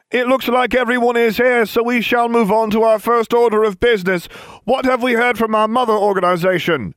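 high, loud and pompous, slowing to a crawl; hammed-up chairman performing for an eavesdropper; overacted chairman. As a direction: ham it up as a stagey meeting chair. Staged mock meeting, overacted.

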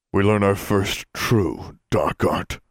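slow, solemn dark announcement; slow ominous declaration. A narrator's reading.

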